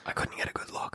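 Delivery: hushed scout's whisper. Acted. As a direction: whisper back under your breath. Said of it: "and he whispers".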